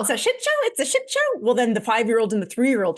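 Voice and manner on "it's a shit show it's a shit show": put-on high, gleeful kid chanting; excited child voice. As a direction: squeal it like a delighted seven-year-old. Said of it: "the oldest girl goes".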